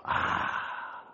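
soft, voiceless "ah"; contented sigh. Acted. Believers experiencing his kingdom, sighing in relief. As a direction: let out a long airy sigh.

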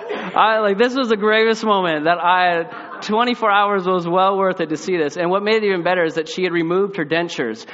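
preacher laughing at the family story, loud; laughing excitement. Real, not acted. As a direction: tell it cracking up. Laughing at the memory.

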